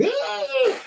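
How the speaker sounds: very high retold shriek; imitated high-pitched scream; mock girly scream. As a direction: squeal like a startled girl. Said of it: "I screamed like a girl".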